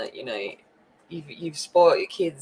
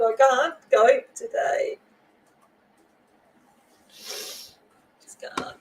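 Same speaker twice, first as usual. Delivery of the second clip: slow, then a near-whispered break; holding back tears; 6 semitones higher, much slower than usual. Real, not acted. Streamer apologizing to chat, fighting back tears. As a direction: voice giving way. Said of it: "I just gotta collect myself".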